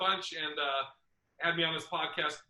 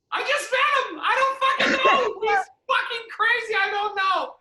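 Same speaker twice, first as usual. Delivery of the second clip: much higher, louder, strained bewildered voice; frantic high squeal; 7 dB louder than usual. Retold or imitated. Asked about his boy, answering in a high frantic squeal. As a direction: squeal it out like you're totally baffled.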